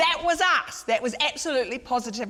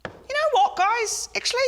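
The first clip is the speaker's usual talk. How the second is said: strained high voice. Acted. Minister flustered, voice jumps up.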